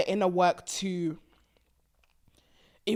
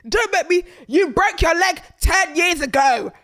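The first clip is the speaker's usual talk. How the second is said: higher and louder, yelling heal and get over it; exasperated shout; 8 semitones higher, 7 dB louder than usual. Real, not acted. Fed-up vlogger yelling at the ex to get over it. Ranting at the ex.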